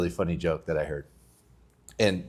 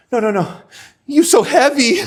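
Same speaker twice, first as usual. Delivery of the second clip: much higher, laughing foreign-student voice; punchline in a high accented voice through laughter; laughing as a foreign student with broken English; 9 dB louder than usual. Retold or imitated.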